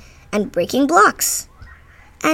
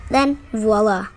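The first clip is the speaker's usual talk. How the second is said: usual speaking voice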